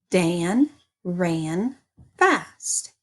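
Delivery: slow, even model sentence; plain statement. Shown as a demonstration. Teacher modelling a statement for the class, slow and even. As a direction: read it out slowly and evenly, just telling. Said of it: "Listen to the sentence".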